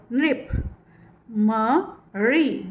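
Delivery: ordinary talk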